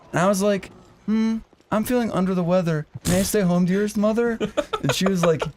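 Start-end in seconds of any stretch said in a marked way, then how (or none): 1.1-4.4 mock sickly politeness. Faking sickness to mother.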